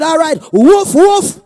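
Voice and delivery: woof it out high and loud like a dog; a hammed-up barking reply; mock dog bark. Preacher mocking a man who called him dog, barking back loud.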